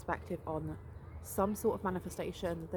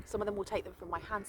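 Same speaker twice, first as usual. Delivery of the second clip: normal talking voice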